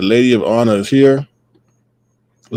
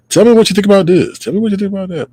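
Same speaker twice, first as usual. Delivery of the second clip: higher, fast, eager schoolkid chatter; school bus chatter; 9 semitones higher, faster than usual. Retold or imitated. Host imitating kids on the school bus, higher and quick. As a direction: mimic a chatty kid on the bus, quick and high. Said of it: "when people ride the school bus".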